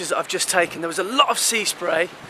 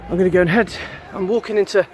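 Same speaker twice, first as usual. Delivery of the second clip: usual speaking voice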